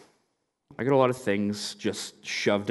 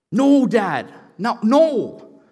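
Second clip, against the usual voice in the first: higher, louder firm refusal; loud flat refusal; 10 semitones higher, 8 dB louder, much slower than usual. Retold or imitated. Teens refusing the dirt brownies from dad, loud refusal. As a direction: refuse it loudly.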